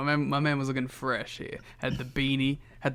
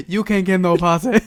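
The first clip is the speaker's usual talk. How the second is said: loud, higher mock Dusty Rhodes voice; Dusty impression; 5 semitones higher, 11 dB louder than usual. Laughing co-host quotes Dusty's retort, loud mock impression. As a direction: belt it out like Dusty. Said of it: "he just looked at him and said".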